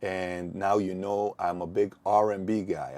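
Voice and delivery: ordinary talk, nothing marked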